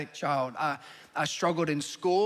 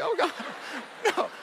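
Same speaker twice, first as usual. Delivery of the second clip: laughing dismay; 9 semitones higher than usual. Retold or imitated. Dad reacting.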